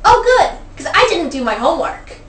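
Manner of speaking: put-on high, loud, gleeful child; spring the punchline like a cheeky kid. Kid's punchline in joke.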